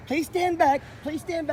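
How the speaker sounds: high, whiny mock of a woman; mocking her voice. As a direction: parrot her in a prissy high voice. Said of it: "with your cute little dot and go".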